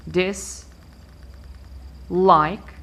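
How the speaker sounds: dis, long pause, like; divided into syllables. Shown as a demonstration. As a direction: split it in two: "dis" and "like". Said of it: "I start by dividing my word".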